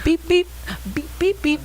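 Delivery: high, short mimicked beeps; playful high-pitched beep noises; imitated phone beep. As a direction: copy a phone's beeping in a high voice. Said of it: "I heard a beep".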